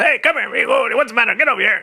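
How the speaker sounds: high, booming imitation of a loud father; loud-talking dad. Son imitating his loud father calling him over. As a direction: call out like a boisterous dad. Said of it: "a very loud talking father".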